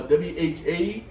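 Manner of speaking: slow letter-by-letter "W H A Z A M"; spelling out. Retold or imitated. Spelling the greeting for a coworker, slow and letter by letter. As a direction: spell "W H A Z A M" with gaps between letters. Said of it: "How you spell it?".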